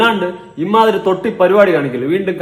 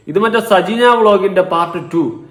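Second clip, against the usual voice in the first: ordinary talk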